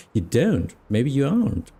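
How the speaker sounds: ordinary delivery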